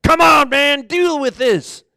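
loud, high-pitched defiant challenge. Acted. Defiant man on the cross, demanding. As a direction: throw it out as a demand. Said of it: "One man was defiant".

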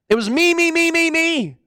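high, insistent chant of me; self-centred clamour. Acted. Preacher portraying the defiant man's selfishness, insistent. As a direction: hammer each word, pitched high.

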